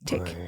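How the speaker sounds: low, soft ticking sound; clock tick sound. Pretend. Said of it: "I can make sound effects".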